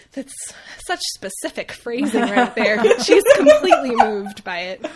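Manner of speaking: words breaking into loud laughter; laughing. Real, not acted. Cracking up at the phrasing.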